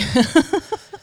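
loud high laugh; rising laugh. Real, not acted. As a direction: burst out laughing. Laughs at stealing hair dye.

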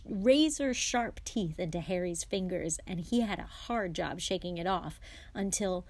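ordinary delivery

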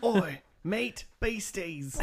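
Australian accent, lightly mocking. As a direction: play a cartoon Australian hailing his mates.

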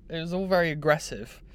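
ordinary talk

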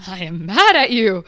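loud, overacted mock rage; mock anger acting. Podcaster mimicking Eric's hardcore anger scene, mock angry. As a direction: parody an actor straining to look furious. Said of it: "some hardcore anger acting".

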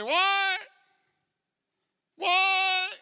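high, loud acted wail; mock anguished protest; questioning God. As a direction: cry it out like a dismayed believer. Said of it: "when something unexpected happened. They say".

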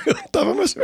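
high, laughing protest. Retold or imitated. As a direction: laugh it out, a bit defensive. Laughing answer to the guys. (rindo, protestando que estava lá)

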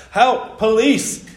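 high, loud panicked cry; old man crying for help as the youths run riot. A narrator's reading. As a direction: yell out for the police. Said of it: "cried Mister McNumpty".

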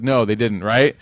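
ordinary talk, nothing marked

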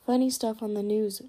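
plain reading voice

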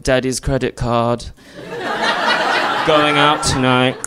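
put-on posh British girl's bored drawl, laughing; a British accent, said through laughter. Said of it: "in a British accent".

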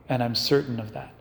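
usual speaking voice

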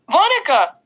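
put-on a toddler tugging for attention; small child calling. A narrator's reading. Little brother calls to the babysitter, high child voice.

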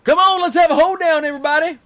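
high, loud hillbilly holler; hillbilly hoedown shout. Pretend. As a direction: whoop it up like a country hoedown caller. Hillbilly Jim hosting the broadcast.

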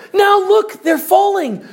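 high, loud cry of distress; acted frantic outcry; urgent alarm. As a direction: plead urgently, pointing out the danger. Alarmed plea as people fall.

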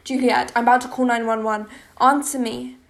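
usual speaking voice

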